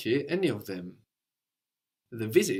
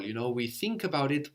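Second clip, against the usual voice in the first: normal talking voice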